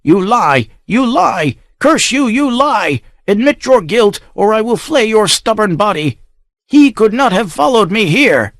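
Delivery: high, frenzied shrieking accusation. Acted. Mad count shrieks at the whipped child. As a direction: rave like a madman with the whip.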